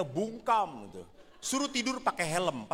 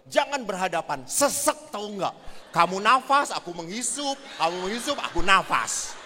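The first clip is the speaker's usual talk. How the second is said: louder, complaining wife voice; annoyed wife; 3 semitones higher, 6 dB louder than usual. Retold or imitated. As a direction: scold him for breathing in her face. Wife complaining about breathing. (istri mengomel karena sesak, lebih keras)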